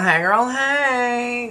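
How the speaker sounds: put-on high, slow, drawn-out hey girl hey; imitating TV girl. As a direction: sing out the greeting like a reality star. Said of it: "that girl was like".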